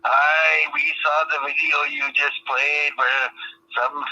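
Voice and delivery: broad mock Scottish brogue throughout; put-on Scottish accent. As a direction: play a hammed-up Scotsman, aye. Fake Scot on a prank call.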